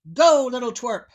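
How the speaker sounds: loud giant command. Acted. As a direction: snap it at him. Giant orders the tailor.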